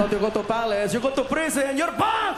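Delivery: loud, rising prank-show holler; mock reveal shout. Prank host revealing it's prison, building shout.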